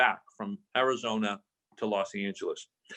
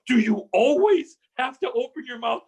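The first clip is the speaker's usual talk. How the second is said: higher, louder, mock-annoyed friend voice; exasperated scolding; 9 semitones higher, 3 dB louder than usual. Friend Brad scolds Tony in the elevator, exasperated and higher. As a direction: play the fed-up buddy scolding him.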